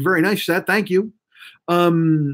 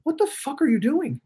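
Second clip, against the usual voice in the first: high-pitched, softer mock disbelief; retold incredulous confrontation; 10 semitones higher, 5 dB quieter than usual. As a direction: imitate a friend's baffled scolding, pitched high. Gym friend confronts him, retold.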